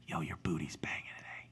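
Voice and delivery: sly, hushed whisper; whispered compliment. Acted. Boyfriend sneaks a compliment at work, whispering. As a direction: whisper it like a secret come-on.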